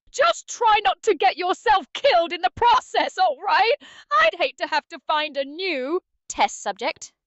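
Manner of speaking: loud, high-pitched dramatic warning; theatrical send-off. Acted. AI companion sending the player into battle, loud and theatrical. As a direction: raise pitch and volume for a grand send-off.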